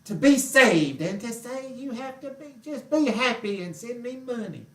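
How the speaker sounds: high, drawn-out preacher impression; mocking grinning televangelist. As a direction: preach through a huge toothy grin, hammed-up. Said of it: "with that big old toothy grin".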